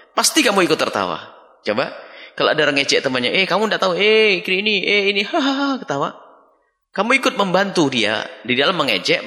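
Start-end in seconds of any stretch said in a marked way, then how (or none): 3.3-5.2 mock teasing. Voicing a friend mocking someone. 5.2-5.8 mock laugh. Acted laugh of the mockers.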